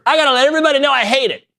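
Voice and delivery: high, fast whiny mock voice; mock commenter. Host mocking viral-tweet commenters, high fast whiny voice. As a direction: parody an outraged tweeter.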